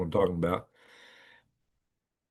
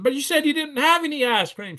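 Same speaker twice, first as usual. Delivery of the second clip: confused friend. Retold or imitated. Confused Ronnie protests.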